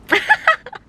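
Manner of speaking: embarrassed high-pitched giggle; high squeaky laugh. Real, not acted. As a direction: burst into a high giggle at the mix-up. Laughs at own slip.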